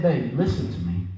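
very low, quiet fatherly warning; low and soft, with steady authority; firm father. Retold or imitated. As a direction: drop right down into a dad's grave instruction. Dad warns daughter not to go.

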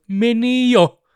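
high, loud cocky retort; cocky mock dismissal. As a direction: toss it off like a smug champ. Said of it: "Conor McGregor oli". (Conor kuittaa sakot: korkea, kova ylimielinen tokaisu)

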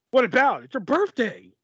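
imitated defensive bickering; mock quarrel. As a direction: replay a tense spat, hammed up. Replays quarrel over phone call.

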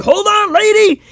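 very high, hammed-up cry to a passenger; exaggerated movie-hero alarm; mock frantic warning. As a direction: imitate a panicked driver calling out. Acted warning before the jump.

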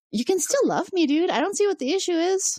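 high, airy casual shrug; lightly mock the careless lover; breezy dismissal. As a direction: Troi brushing off Riker's hurt. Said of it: "she's instead like".

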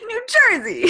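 a hammed-up, high New Jersey accent through laughter; loud, laughing stab at a New Jersey accent; attempted New Jersey accent. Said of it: "because I can't do the accent".

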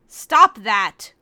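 loud sharp command; snapping order. Acted. Korra slapping crying Kagome, loud mock order. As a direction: bark the order at her.

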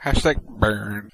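slow deadpan. Real, not acted. Co-host reacts to roast.

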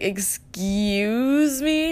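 slow, drawn-out offended "excuse me"; mock-offended retort; indignant. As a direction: stretch it out like you can't believe what you heard. Indignant reply to date.

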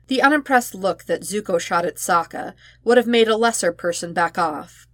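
plain reading voice

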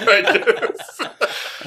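dry quip dissolving into laughter; laughing. Retold or imitated. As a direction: say it through a laugh. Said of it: "the other dude in the room was like".

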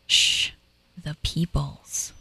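imitating her toddler's slow hushed "Shh, the peoples"; hushed little girl. Daughter hushes about ghosts on stairs.

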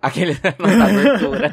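talking through laughter; laughing. Real, not acted. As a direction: laugh out the line. Laughing at the intro quote.

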